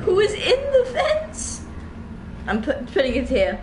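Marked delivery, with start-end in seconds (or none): 0.0-1.6 much higher, slow, laughing in fright; startled laughing cry. Real, not acted. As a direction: yelp it through a laugh. Startled by something in game.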